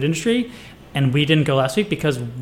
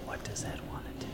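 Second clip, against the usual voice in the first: soft fast ASMR whisper; 20 dB quieter, faster than usual. Pretend. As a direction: breathe it right into the mic. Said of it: "in A S M R voice".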